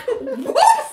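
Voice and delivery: high, laughing disbelief; baffled what. Retold or imitated. Podcaster recalling her reaction, laughing disbelief. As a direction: squeak out total confusion. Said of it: "And I'm like".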